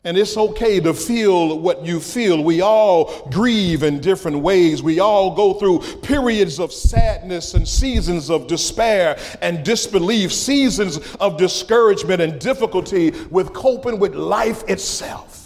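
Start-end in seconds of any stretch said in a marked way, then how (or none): none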